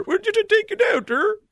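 very high, fast mock Norwegian; Norwegian accent. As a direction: squeak it out in a goofy Norwegian accent. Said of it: "That's a Norwegian accent".

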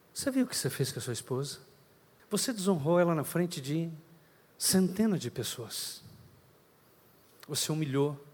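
soft, low, tender rebuke; gentle voice of God. Acted. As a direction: speak as a loving father correcting his son. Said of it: "vem aquela voz suave, doce". (voz suave e doce de Deus repreendendo)

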